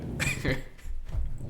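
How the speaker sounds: unvoiced chuckle; breathy laugh. Real, not acted. Laughing at memory.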